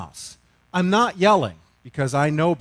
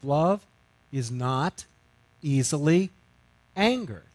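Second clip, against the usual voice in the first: Love ... is not ... easily ... angered, each word set apart by pauses; slow word by word; 2 semitones lower than usual. Shown as a demonstration. Preacher dwelling on the verse, word by word with pauses. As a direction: weigh out every word separately. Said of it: "pauses between each word".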